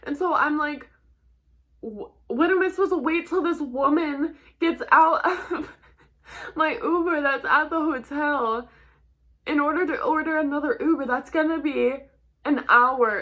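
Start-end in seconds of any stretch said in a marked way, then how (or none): 1.8-13.2 high, loud panicked complaint, retold; retelling a rising panic, pitched up and loud; freaking out. Rider retelling her stolen-Uber panic, freaking out. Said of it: "I started freaking out".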